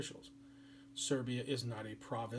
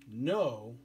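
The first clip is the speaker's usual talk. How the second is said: a sudden louder, flat no after the yeses; firm no; 5 dB louder than usual. Retold or imitated. Serbia refusing Austria-Hungary, a firm no. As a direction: slam the door with one word.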